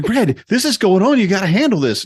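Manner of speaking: high, urgent wife's voice; Kitty pleading up high for help; mock Kitty. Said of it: "And she's like".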